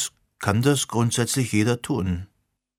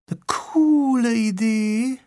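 much higher, slow and dreamy; elf girl voice; 12 semitones higher, slower than usual. Acted. Sirona the elf girl praising Leo's idea in a high, slow, dreamy voice. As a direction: float the words up high like a dreamy young elf.

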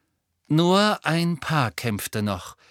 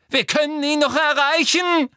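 high, loud frantic pleading; desperate shout; 13 semitones higher, 6 dB louder than usual. Acted. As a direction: beg them frantically to save him. Harry fighting Lupin. (Harry fleht verzweifelt und laut)

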